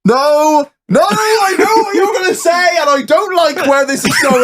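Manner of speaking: high, shrieking no, no, no; begging the story to stop, high and wailing; horrified wail. Real, not acted.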